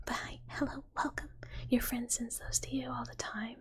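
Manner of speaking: ordinary talk, nothing marked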